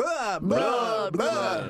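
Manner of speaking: Dracula blah. Retold or imitated. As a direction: ham up the vampire blah. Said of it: "And now they go".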